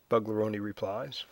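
plain reading voice

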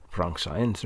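ordinary talk, nothing marked

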